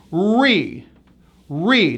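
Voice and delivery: Re with a heavy R into EE; re, drawn out and falling; hard R. Shown as a demonstration. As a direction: come down hard on the R in re. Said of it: "come down hard on this capital R".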